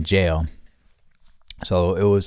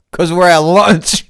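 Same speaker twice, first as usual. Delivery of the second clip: cracking up mid-line; sassy answer breaking into laughter; can't keep a straight face; laughing; 10 semitones higher, 10 dB louder than usual. Retold or imitated. Cheeky answer to the principal.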